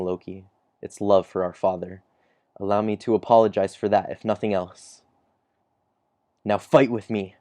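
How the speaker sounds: ordinary delivery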